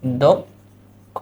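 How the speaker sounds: slow teacher demo; slow pronunciation demo. Shown as a demonstration. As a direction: stretch অদক্ষ for students. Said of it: "এই যে".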